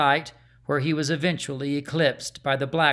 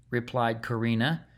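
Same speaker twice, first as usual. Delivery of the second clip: plain reading voice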